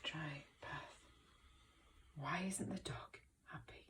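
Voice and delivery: softly whispered. Shown as a demonstration. Teacher whispering to the class in a read-aloud. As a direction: lean in and whisper. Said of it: "whispered aside".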